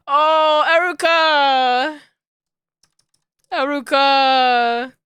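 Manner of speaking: slow, drawn-out delighted call; shrieking jackpot winner. Real, not acted. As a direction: stretch the name out with joy. Drawn-out fond calling.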